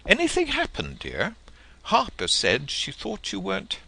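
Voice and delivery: high, concerned motherly voice asking her son; worried mother. Acted. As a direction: play the anxious mother at his door.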